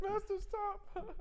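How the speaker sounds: very high, slow elf whine; Dobby squeal. Pretend. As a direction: stretch it like a pleading house-elf. Dobby voice joke.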